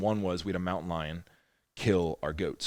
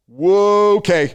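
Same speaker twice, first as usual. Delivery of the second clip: much higher and louder startled cry; startled whoa; 11 semitones higher, 15 dB louder, much slower than usual. Retold or imitated. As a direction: jolt at the sound of shots. Retold reaction hearing gunshots.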